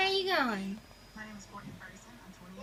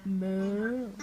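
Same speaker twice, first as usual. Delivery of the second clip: low drawn "Moo" like a cow; low cow moo to a baby; 9 semitones lower, 6 dB quieter than usual. Shown as a demonstration. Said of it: "What's a cow say?".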